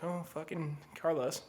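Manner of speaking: the version he says he didn't say, a mild soft groan; mock groan. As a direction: sound fed up that he's calling. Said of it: "No, I didn't actually say it".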